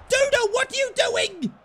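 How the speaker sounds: high, loud disbelief; exasperated shout. Real, not acted. Gamer yelling at his player for a blunder, loud. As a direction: yell at the screen in disbelief.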